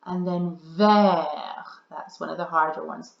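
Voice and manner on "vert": vert slowly, silent t, French r; drawn-out vert; French pronunciation. Shown as a demonstration. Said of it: "Vert is the French word for green".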